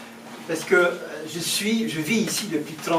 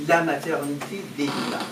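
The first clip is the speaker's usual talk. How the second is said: normal talking voice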